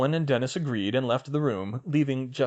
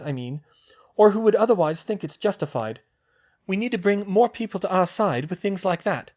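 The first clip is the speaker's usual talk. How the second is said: put-on higher young woman's voice, brisk; girl's voice; 9 semitones higher, 4 dB louder than usual. Hermione planning with Luna, in a higher girl's voice. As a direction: voice the girl, a clear step up in pitch. Female character voiced high.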